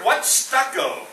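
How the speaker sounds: ordinary talk, nothing marked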